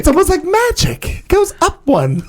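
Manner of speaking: high, slow, wide-eyed Bubbles voice; Bubbles impression. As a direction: spell it out slowly, amazed at the magic. Imitating Bubbles explaining the year.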